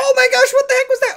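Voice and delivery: startled shout. Acted. Gold startled by shark attack.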